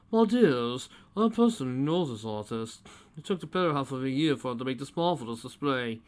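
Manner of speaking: lightly voiced self-important connoisseur; fussy posh old man; pompous gentleman. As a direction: talk down to them grandly.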